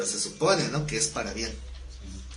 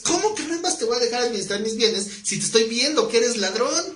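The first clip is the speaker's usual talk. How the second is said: higher, incredulous outburst; indignant disbelief; 7 semitones higher than usual. Acted. Creditor scoffs at the thieving steward, higher and incredulous. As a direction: scoff at the cheeky thief.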